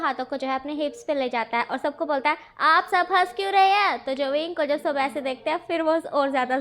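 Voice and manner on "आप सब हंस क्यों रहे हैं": higher, louder indignant demand; annoyed child. Acted. Wing standing on his chair, demanding why everyone laughs, indignant. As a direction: demand it, hands on hips, indignant. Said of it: "उसे गुस्सा आ जाता है".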